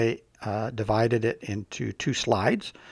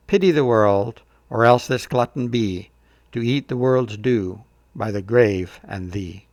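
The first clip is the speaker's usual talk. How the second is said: slow, measured Shakespeare reading, louder in places; poem recital; 5 dB louder than usual. Shown as a demonstration. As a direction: recite the sonnet with weight and care. Reads sonnet aloud.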